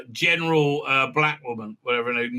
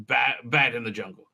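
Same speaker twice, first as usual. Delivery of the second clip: normal talking voice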